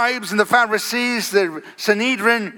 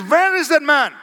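raised, higher-pitched demand; demanding Pharisees; 6 semitones higher than usual. Retold or imitated. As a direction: demand an answer like an angry authority. Pharisees demand the missing man.

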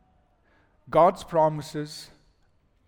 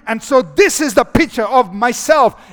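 shouting; 6 semitones higher, 9 dB louder than usual. Real, not acted. Preaching climax, shouting.

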